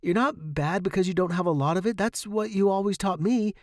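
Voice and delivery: high, earnest reassurance; impassioned devotion. A narrator's reading. Student to dying teacher, earnest devotion. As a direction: plead earnestly, softening by the end.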